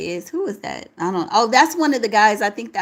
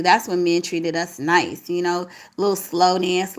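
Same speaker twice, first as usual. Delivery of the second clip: ordinary talk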